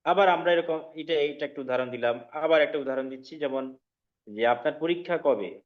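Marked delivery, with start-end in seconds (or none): none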